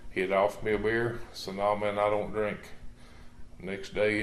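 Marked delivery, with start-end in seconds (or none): none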